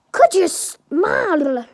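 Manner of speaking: high, loud, drawn-out shout; yelled out. A narrator's reading. Izuku finally interrupts the adults, yelling out. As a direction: burst out yelling, stretching the words. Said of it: "Izuku finally yells out".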